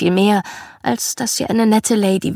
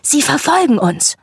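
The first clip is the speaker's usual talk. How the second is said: put-on excited child's exclamation, pitched higher; 6 semitones higher than usual. A narrator's reading. Brother turning to sister in the mobbed car, excited. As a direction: exclaim it with delight, voice lifting high. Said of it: "meinte begeistert".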